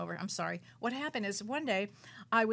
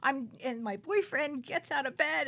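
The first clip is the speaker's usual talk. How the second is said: ordinary talk, nothing marked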